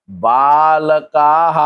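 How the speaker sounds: बालकाः very slowly, "baa-la-kaa-haa"; long-vowel plural. Shown as a demonstration. Teacher giving the plural form बालकाः, long drawn-out vowels. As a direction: hold both long vowels closing बालकाः. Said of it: "का पे डंडा आ गया और हा".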